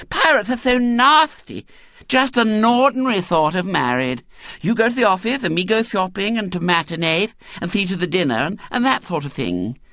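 put-on high, fussy child voice; lisping little girl. A narrator's reading. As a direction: lay down the game as a small girl. Little girl explains playing house.

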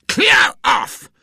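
furious high-pitched shout; loud, enraged bellow; angry roar. A narrator's reading. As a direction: roar it to drive the boy away. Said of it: "roared the professor angrily".

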